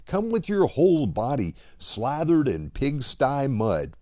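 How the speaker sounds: normal talking voice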